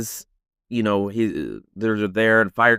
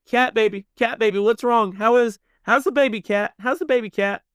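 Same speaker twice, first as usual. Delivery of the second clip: high, frantic tearful pleading; imitated crying; 11 semitones higher than usual. Crying Kelly asks Kat about the baby, mock tearful. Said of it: "Kelly's crying, and he's like".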